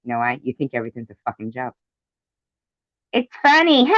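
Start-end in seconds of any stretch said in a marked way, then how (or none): 3.1-3.9 mock voice, much higher and louder; high mocking taunt. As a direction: sneer it like the joker would. Mocking someone who jokes about cancer.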